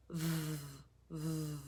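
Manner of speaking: "V" as a soft, low held buzz; letter-sound drill. Shown as a demonstration. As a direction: buzz each "V" through lip and teeth, no vowel. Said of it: "Vincent Vampire Bat says".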